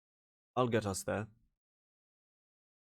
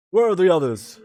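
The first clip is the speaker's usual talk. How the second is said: booming question; 7 semitones higher, 11 dB louder than usual. Acted. Big elf booming.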